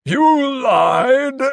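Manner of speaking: high, loud, gurgled accusation; gurgling. A narrator's reading. Carstairs realises Crow's deceit, gurgling in outrage. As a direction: choke out the accusation in a screech. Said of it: "Carstairs gurgled".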